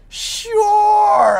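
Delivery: high, drawn-out yes; evasive fib. Retold or imitated. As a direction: stretch it high, dodging the question. Acting his evasive fib.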